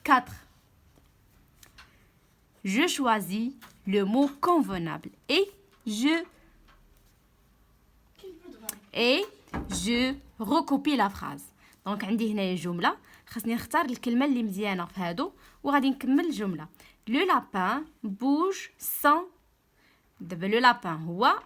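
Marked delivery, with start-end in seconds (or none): 2.6-11.4 very slow, careful dictation pace; slow read-aloud. Shown as a demonstration. Teacher dictating the exercise to pupils, slow and careful. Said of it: "teacher reads exercise instruction". 17.1-19.3 slow, deliberate sentence reading; slow read-aloud. Shown as a demonstration. Teacher reading the sentence to pupils, slow and deliberate. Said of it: "teacher reads example sentence".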